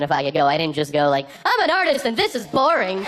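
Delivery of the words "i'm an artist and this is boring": high, theatrical proclamation; mock diva outburst. Comedian imagining walking out on the policeman, hammed-up artist declaration. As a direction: declare it grandly, pitched way up.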